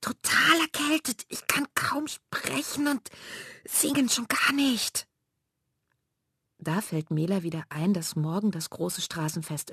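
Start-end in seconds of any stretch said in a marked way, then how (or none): 0.0-5.1 high, hoarse, half-voiceless croak. Acted. Elli explaining she can't sing, hoarse and strained. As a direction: squeeze the words out through a sore throat. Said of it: "krächzt Elli".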